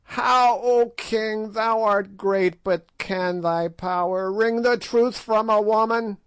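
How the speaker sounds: a hag taunting a king to his face; scornful witch daring the king; mocking old witch. A narrator's reading. Gagool mocks the king.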